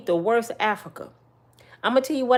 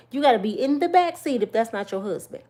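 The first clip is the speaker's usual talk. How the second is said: ordinary talk, nothing marked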